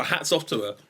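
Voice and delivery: usual speaking voice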